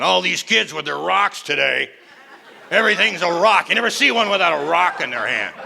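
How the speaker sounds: lightly voiced grumbling old Neanderthal; grumpy caveman. Old Neanderthal complaining about kids, lightly grumpy. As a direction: grouse about kids today like a cranky elder. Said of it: "Neanderthals standing around going".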